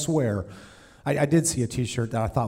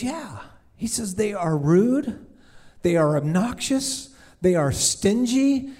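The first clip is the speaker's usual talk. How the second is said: complaining owner; 7 semitones higher, slower than usual. Retold or imitated. Owner complaining of church people.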